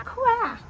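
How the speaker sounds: one high, loud quack; duck quack. Shown as a demonstration. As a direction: quack like a duck for kids to copy. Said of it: "Can you say quack?".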